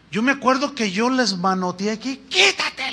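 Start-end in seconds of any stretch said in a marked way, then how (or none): 2.3-2.9 high-pitched retold rebuke; retold scolding. Preacher recalling how he swatted grabbing hands away, scolding. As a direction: reenact shooing a pushy fan away. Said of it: "los regañaba y les decía".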